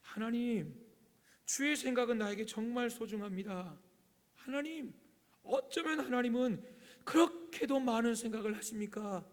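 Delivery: fervent prayer. Acted. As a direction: cry the verse out as a personal prayer. 여러분, 이거예요. (간절히 높여 부르는 기도)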